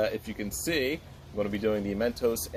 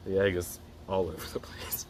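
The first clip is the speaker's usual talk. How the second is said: whispering; 3 semitones lower, 3 dB quieter than usual. Real, not acted. Egg squished all over him.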